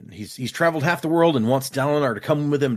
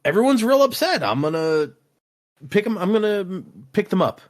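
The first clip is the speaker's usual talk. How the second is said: eager mock Dalinar; 6 semitones higher than usual. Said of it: "He's like".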